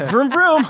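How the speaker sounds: playful engine vroom. Pretend. Revving like an engine.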